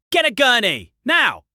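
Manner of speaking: high, loud emergency shout; doctor taking command at the top of her voice; doctor-mode yell. A narrator's reading. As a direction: shout urgent orders across the ER. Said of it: "Doctor mode activated".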